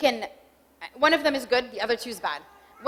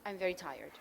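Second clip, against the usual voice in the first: softer and lower, sagging with fatigue; worn-out tired statement; 6 semitones lower, 10 dB quieter than usual. Shown as a demonstration. Spouse telling partner after a long day, weary and soft. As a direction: let "I'm very tired" drop low and soft like you're drained. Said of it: "I come to you and I say".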